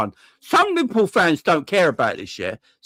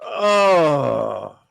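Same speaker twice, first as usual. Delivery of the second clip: frustrated groan. Real, not acted.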